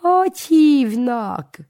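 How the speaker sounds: high, loud, slow crone voice; old woman asking. A narrator's reading. Toothless old woman asks the boy, high and slow. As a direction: quiz the boy like a nosy granny.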